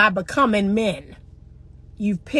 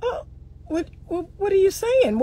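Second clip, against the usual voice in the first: high, breathless oh what; hammed-up disbelief; mock surprise; 8 semitones higher than usual. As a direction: play overwhelmed amazement. Acted surprise at car gift.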